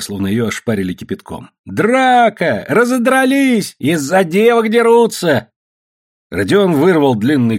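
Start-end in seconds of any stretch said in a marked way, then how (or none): 1.6-5.5 high, drawn-out howl of alarm; wailing alarm. A narrator's reading. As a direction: raise the alarm across the camp. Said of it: "завыла какая-то девка".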